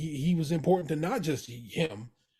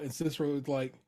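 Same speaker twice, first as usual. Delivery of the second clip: ordinary delivery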